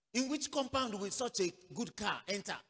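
loud, high incredulous challenge; scoffing disbelief. Retold or imitated. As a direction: scoff loudly at the very idea. Voicing scoffers doubting the man's success.

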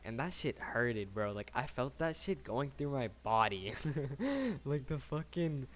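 ordinary talk

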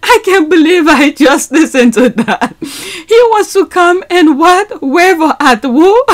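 can't believe what she heard; laughing disbelief. Real, not acted.

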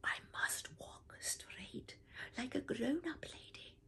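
soft unvoiced whisper. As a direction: whisper it to yourself like a secret vow. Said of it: "she whispered to herself".

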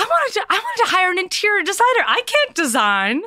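high, exasperated wife imitation; mock pleading. Wife tells husband she wanted a decorator, mock pleading.

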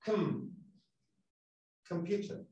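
COM in COMPUTER softly, with a reduced schwa; media vocal. Shown as a demonstration. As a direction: barely voice the first vowel of COMPUTER. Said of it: "Es la mitad de una vocal". (COMPUTER suave, con media vocal en COM)

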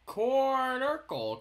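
high, slow, loud proclamation of Cornercle; announcing new word. Shown as a demonstration. Names his new shape, proclaims it. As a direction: unveil the word Cornercle like a grand name. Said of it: "We'll call it a cornercle".

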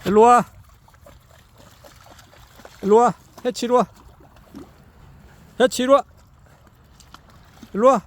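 loud call to a dog. Real, not acted. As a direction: call the dog over from afar. Calling dog from afar.

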